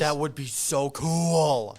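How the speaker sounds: loud, drawn-out thrilled cry; real excitement. Real, not acted. Friend thrilled by imagined demon powers, loud excitement. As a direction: gush it loudly, stretching the words out.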